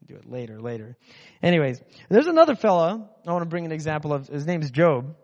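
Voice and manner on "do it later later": much softer, lower lazy mumble; lazy grumble. Retold or imitated. Someone skipping chores, soft lazy mumble. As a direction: mumble it like you can't be bothered. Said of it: "and you're like".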